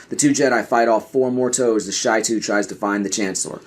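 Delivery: plain reading voice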